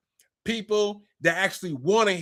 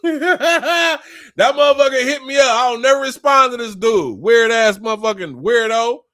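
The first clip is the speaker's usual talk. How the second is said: loud, higher, cracking up into a yell; laughing shout; 5 semitones higher, 6 dB louder than usual. Real, not acted. Telling viewers about a weird texter, loud laughing rant. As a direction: laugh and holler at the absurdity.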